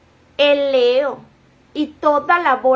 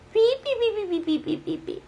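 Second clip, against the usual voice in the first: censor beep; 7 semitones higher, 7 dB quieter than usual. Pretend. Beeping out a word.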